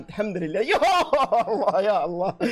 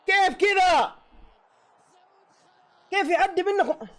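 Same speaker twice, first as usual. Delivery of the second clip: outraged cry; 13 semitones higher, slower than usual. Real, not acted. Outraged at his team's defence.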